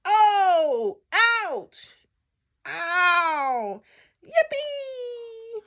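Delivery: high drawn-out yelps, ending in a squeal; big cartoon cries; yelled. A narrator's reading. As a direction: yelp in pain, then squeal with glee. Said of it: "and yelled".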